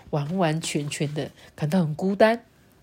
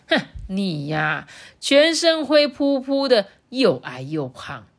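slow, scornful taunt; cold sneer; 3 semitones higher, slower than usual. Acted. Lulu taunts Rourou with nasty words, sneering slowly. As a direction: draw out each insult with a sneer. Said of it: "鲁鲁就冷笑说".

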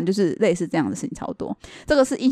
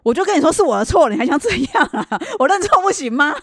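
higher, louder mock exasperation breaking into a laugh; retold exasperated rant, higher and louder; exasperated outburst; 7 semitones higher, 4 dB louder than usual. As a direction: blow up at him, half laughing. Retelling her exasperated outburst at boyfriend.

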